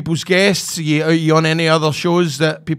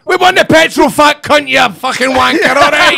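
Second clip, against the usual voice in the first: much higher and louder, play-shouting insults; mock yelled abuse; 8 semitones higher, 5 dB louder than usual. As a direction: bellow the jibes like a rowdy fan, in jest. Jokingly hurling abuse back.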